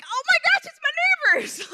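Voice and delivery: high, loud squeal of delight; excited discovery. Retold or imitated. Speaker recalls spotting her neighbors on screen, excited squeal. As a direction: burst out with thrilled, high-pitched recognition.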